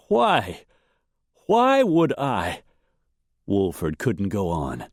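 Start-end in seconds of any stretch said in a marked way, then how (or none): 0.1-2.6 high, distressed protest; slow, anguished questioning; pained whimper. A narrator's reading. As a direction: falter in pain, pitched high. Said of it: "He lay whimpering in pain".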